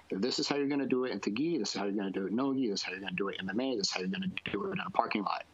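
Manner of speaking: quick-fire run through the variants; rattled-off instruction. Acted. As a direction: rattle it off like a rushed demonstration, fast. Acting out himself teaching every variant.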